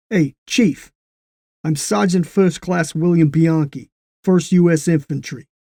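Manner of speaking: a cocky sergeant announcing himself; brash American GI. Acted.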